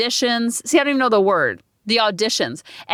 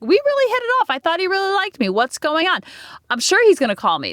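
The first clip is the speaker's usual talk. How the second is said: put-on hopeful teen girl; 7 semitones higher than usual. Young self hoping he calls.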